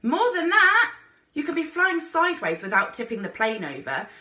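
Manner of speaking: high, loud sudden yell; urgent yell, easing off; shouting. A narrator's reading. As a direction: bark the correction over the roar. Instructor shouting at nervous pilot.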